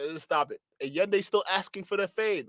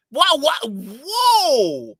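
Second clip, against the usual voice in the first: startled whoa; 6 semitones higher, 6 dB louder, much slower than usual. Real, not acted. Startled by the angry host.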